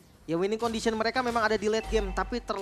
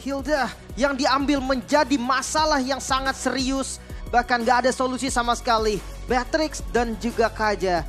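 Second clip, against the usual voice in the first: louder, slow, punchy caster call; loud excited commentary; 5 semitones higher, 6 dB louder than usual. Real, not acted. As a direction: hammer each point over the crowd. Caster analyses at full volume.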